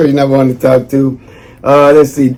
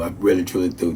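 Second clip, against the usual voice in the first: softer, fading thanks; sincere thanks; 6 semitones lower, 11 dB quieter than usual. Real, not acted. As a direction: let the repeat sink quieter. Repeated softer.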